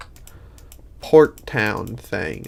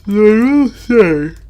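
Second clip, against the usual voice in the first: high, loud, stretched-out yawn; 11 semitones higher, 12 dB louder, slower than usual. Real, not acted. Gamer admitting yawns just come on, yawning. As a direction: talk right through a big yawn. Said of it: "The yawn just kinda comes to me".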